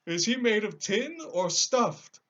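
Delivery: high, loud, slow lion voice; a big cowardly lion protesting. Acted.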